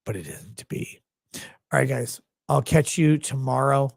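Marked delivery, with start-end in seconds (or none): none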